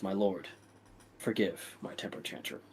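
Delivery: low, soft humble apology; bowing servant. Acted. Servant bowing to his lord, low and humble. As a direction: grovel to the lord, hushed. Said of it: "he bows, he goes".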